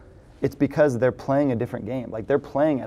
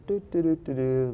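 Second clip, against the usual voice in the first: idle, aimless do-do-do humming; coasting hum; 3 semitones higher, 4 dB quieter than usual. Retold or imitated. Coworker at the office coasting, bored idle humming. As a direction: hum it like you're just killing time at work. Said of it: "just kinda coasting".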